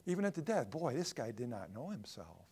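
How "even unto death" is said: Jonah's angry retort. Retold or imitated. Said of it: "he said".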